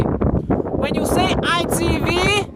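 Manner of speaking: ordinary delivery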